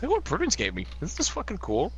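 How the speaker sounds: ordinary talk, nothing marked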